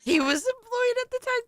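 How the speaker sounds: squeaky high-pitched mockery; mocking high voice.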